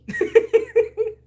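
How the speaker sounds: loud high giggle; bright real laugh burst; high burst of laughter. Real, not acted. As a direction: let the laugh break out high. Laughing at her own line.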